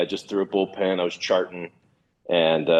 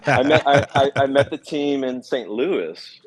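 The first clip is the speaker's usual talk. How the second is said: laughing; 6 semitones higher than usual. Real, not acted. Recalling call-up to majors.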